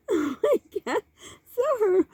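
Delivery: real laughter, recovering between words; laughing to tears. Real, not acted. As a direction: struggle to resume the story while cracking up. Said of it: "I got tears in my".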